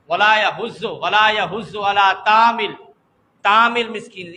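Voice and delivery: "یحض" with plain h and z, "طعام" flattened to taam; common mispronunciation. Retold or imitated. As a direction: imitate faulty recitation of "طعام المسکین" without ain. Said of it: "اس کے ساتھ ساتھ واو بھی گیا".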